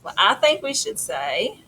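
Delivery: ordinary delivery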